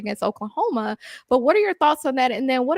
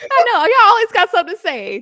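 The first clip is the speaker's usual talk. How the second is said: high, loud teasing rebuke; playful scolding; 8 semitones higher, 6 dB louder than usual. Real, not acted. Host teasing a Florida State fan, playful scolding. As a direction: chide them with raised pitch and volume.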